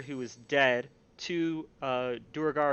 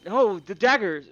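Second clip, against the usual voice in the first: guard realizing; 5 semitones higher, 6 dB louder than usual. Acted. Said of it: "and he goes".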